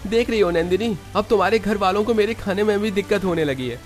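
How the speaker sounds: high-pitched, aggrieved grumbling; offended son-in-law airing his grievances; aggrieved complaint. Acted. Husband grumbles to wife about in-laws.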